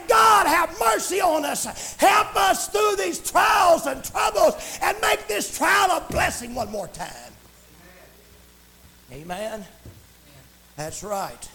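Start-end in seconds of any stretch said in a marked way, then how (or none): 0.1-7.1 high, stretched preacher's plea; crying out to God. Acted. Preacher pleading for mercy through trials, crying out to God. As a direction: cry out a fervent prayer, slowly. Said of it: "we need to cry out to God".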